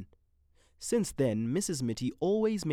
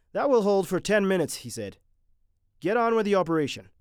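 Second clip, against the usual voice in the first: louder, higher brisk commands; commanding surgeon; 8 semitones higher, 8 dB louder, faster than usual. A narrator's reading. Mitty ordering the team to continue, commanding. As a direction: take charge, brisk and sure.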